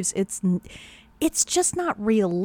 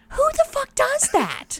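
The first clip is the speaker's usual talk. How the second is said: high, incredulous outburst. Real, not acted. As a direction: ask in disbelief.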